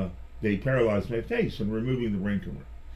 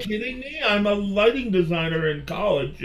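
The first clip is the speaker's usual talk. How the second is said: thrilled, pitched up and louder; excited; 10 semitones higher, 5 dB louder than usual. Retold or imitated. Guest tells the host how he jumped at the lighting booth, thrilled. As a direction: blurt it out in disbelief and delight.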